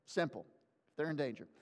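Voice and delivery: put-on low, quiet, matter-of-fact kid's answer; chill. Father echoes his laid-back son, chill. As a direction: toss it off low and unbothered. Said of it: "pretty chill kid right there".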